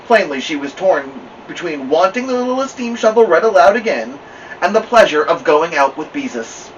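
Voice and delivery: ordinary delivery, nothing marked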